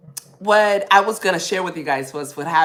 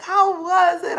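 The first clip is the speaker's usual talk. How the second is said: mom asking; 11 semitones higher than usual. Retold or imitated. Daughter imitating her mom asking about the trip. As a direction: call out like an eager mom.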